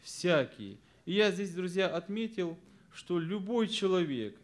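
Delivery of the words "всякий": ВСЯКИЙ alone, low and firm, falling; stressed answer. Shown as a demonstration. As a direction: answer with an emphatic, lower «Всякий». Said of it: "первое ударение я поставил на слово".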